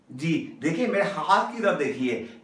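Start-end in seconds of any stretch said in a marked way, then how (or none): none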